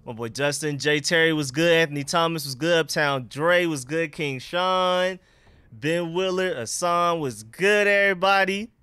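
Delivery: usual speaking voice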